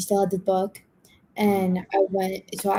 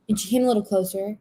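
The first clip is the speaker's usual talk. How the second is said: ordinary talk, nothing marked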